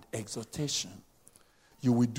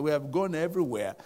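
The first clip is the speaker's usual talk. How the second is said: usual speaking voice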